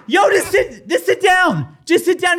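high shouted sit down; mock yell. Wife yelling at the man to sit, shouting. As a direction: yell it as the wife backing him up. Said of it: "Jenny's trying to help me, being like".

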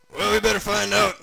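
high, loud character proclamation; excited proclaiming. Acted. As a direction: announce it big and eager. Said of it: "Uncle Creepy proclaimed".

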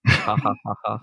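spelled-out fake laugh, ha ha ha; deadpan mock laughter, each ha separate. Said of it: "Friends laugh together".

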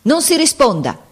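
high, emphatic command falling at the end; shouted order. A narrator's reading. Captain refusing the surrender demand, shouted order. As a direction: cry out a firm order. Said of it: "egli gridò".

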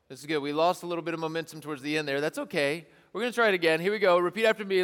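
normal talking voice

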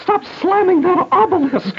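slow high scold; drawn-out squealing order; high mock scolding.

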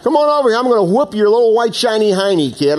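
high, loud mock taunt; hammed-up, high-pitched challenge to a fight; trash talking. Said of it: "this is the original trash talking".

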